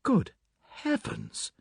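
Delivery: husband horrified at being accused; shocked cry. Acted. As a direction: recoil at the accusation. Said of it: "cried Inglethorpe".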